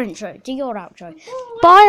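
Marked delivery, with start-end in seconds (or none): 1.6-1.9 loud, silly possessed-cow goodbye; high, hammed-up cow wail. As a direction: bellow bye like a possessed cow. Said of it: "Sounds like you're a possessed cow".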